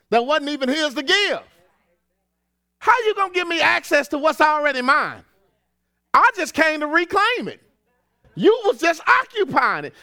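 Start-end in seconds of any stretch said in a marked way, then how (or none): 2.8-9.9 rising preacher's voice, louder; fired-up pulpit preaching. Acted. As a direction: build the sermon, higher and louder. Preaching builds up.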